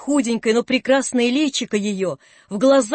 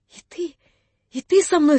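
breathless hopeful exclamation; pleading cry; 5 semitones higher than usual. A narrator's reading. Wife crying out hopefully as her husband dresses to go. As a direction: cry out, not daring to believe. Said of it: "вскрикнула она с мольбой".

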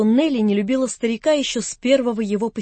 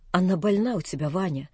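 much lower, much softer, defeated; crushed old man; 4 semitones lower, 6 dB quieter than usual. A narrator's reading. Old man leaving, crushed, quietly to Vanya. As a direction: say it as a broken man.

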